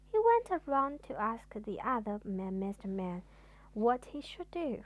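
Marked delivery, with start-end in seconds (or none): none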